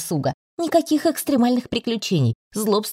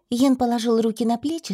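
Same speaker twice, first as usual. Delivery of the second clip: ordinary delivery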